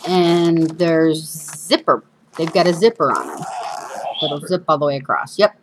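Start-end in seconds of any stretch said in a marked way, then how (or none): 1.7-5.4 whistling through a gap in the teeth; lisping whistle on the s sounds; missing front teeth. Real, not acted. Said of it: "still missing my front teeth".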